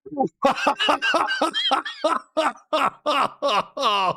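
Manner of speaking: long high-pitched belly laugh; helpless laughter. Real, not acted. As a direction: laugh until the breath runs out. Cracks up at the image.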